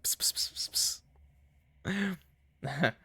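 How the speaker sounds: hushed, repeated cat-calling psst; imitated pet-summoning hiss; whispered psst-psst. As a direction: coax a ghost with quick voiceless pssts. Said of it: "attract ghosts by being like".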